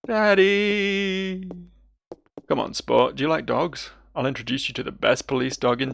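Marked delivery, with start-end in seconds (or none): 0.1-1.5 put-on high, long-held child's cry for father; high sustained kid call; child calling Daddy. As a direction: stretch it out like a little kid calling.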